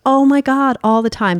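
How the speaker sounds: ordinary talk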